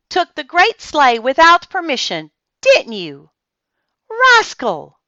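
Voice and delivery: loud, high angry scolding; a father telling off his daughter. A narrator's reading.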